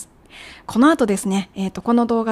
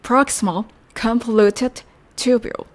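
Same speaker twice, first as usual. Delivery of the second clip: "proximal convoluted tubule" spelled out slowly, each syllable clear; slow, careful teacher's demo of "proximal convoluted tubule"; slow model pronunciation; slower than usual. Shown as a demonstration. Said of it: "次は、筋維尿細管です".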